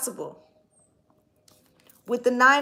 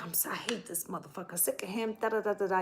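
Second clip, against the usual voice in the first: fast, much softer muttering; muttered grudge; 2 semitones lower, 10 dB quieter, faster than usual. Retold or imitated. Imitating a bitter ex-wife cursing under her breath. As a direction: grumble it under your breath, quickly. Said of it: "you're like".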